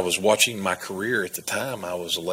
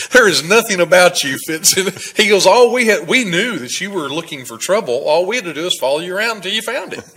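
laughing while quoting; 9 semitones higher, 8 dB louder than usual. Retold or imitated. Retelling officer, laughing through it.

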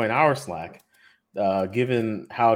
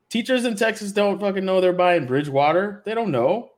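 much higher and louder, insistent; emphatic loud; 9 semitones higher, 5 dB louder than usual. Real, not acted. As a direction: hammer the point home, raised voice. Heated point about teachers' pensions.

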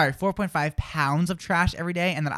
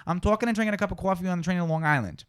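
quick New York City accent, rounded coffee vowels; faster than usual. Shown as a demonstration. Host demonstrates a New York City accent. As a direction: talk fast like a New York City local. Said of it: "New York City".

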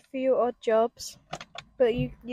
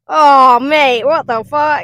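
loud, slow stunned cry; amazed raver; 3 semitones higher, 18 dB louder, slower than usual. Retold or imitated. Ravers hearing a fat drop, loud amazed cry. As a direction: react like the drop floored you. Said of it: "they've all just gone".

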